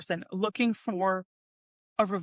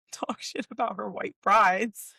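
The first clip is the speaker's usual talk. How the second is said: ordinary talk, nothing marked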